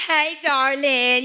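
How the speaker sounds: slow, loud, high taunting croon; teasing. Retold or imitated. Taunting her co-star's real husband, slow loud tease. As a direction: drawl it out to needle her. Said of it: "And I'm like".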